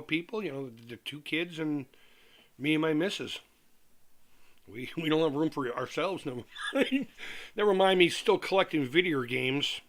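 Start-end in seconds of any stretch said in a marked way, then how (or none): 6.3-7.1 laughing through words. Real, not acted. Laughs mid-phrase.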